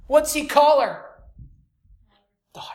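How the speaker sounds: ordinary talk, nothing marked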